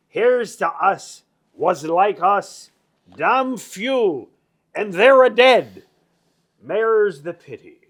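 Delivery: slow, hammy attempt at a Scottish accent; a toast in a try-hard Scottish accent. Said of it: "I don't do a Scottish accent, but I'll try".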